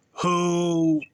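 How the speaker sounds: normal talking voice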